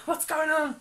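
mock bewilderment. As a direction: play baffled, laughing at yourself. Acted confusion at big pile.

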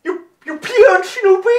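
high, loud, laughing question; astonished German-accented cry; incredulous delight. Acted. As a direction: squeal it in amazed amusement. Kaiser reacts incredulously.

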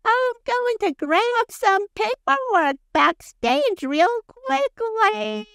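high, drawn-out imitation of an old mom; mock old mother. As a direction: stretch it out in a high mock-granny voice. Mimics Colty's mom announcing her errand.

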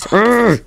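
loud, high "urgh" of alarm; sudden yelp cutting off the chat; startled grunt-scream. Real, not acted. Player startled mid-sentence in game, loud high yelp. Sudden scare while playing.

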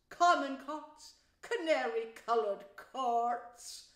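slow, swelling, grandiose rapture; rapturous Toad. Acted. Toad dreams of his magnificent charge, rapturous and boastful. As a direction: declaim it grandly, stretching the big words.

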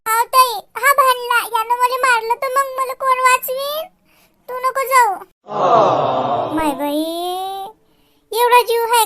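whiny tattling to big sister; child's complaint. Acted. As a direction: younger kid complaining to sister. S0 voices child then mother.

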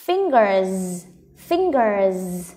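"Fingers" ending in a long buzzing z. Shown as a demonstration. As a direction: draw out the final z of "fingers". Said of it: "mình sẽ có cái z ở đằng sau nhá". (kéo âm z cuối "fingers")

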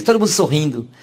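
ordinary talk, nothing marked

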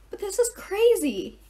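high, loud, drawn-out protest; upset cry. A narrator's reading. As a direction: cry out in disbelief. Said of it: "Annabeth cried".

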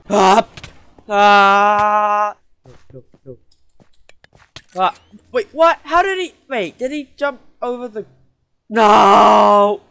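loud high panicked screams; panicked screaming. Real, not acted. Ambushed and killed.

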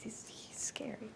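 hushed whisper. Real, not acted. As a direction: breathe it under your breath. Hushed comment on scary rival.

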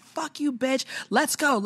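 high-pitched mock fighting talk; retold cursing. Retelling her curse at the attacker, high mock anger.

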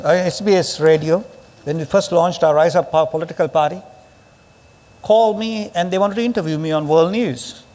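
usual speaking voice

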